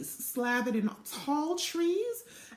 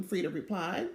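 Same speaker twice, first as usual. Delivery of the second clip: plain reading voice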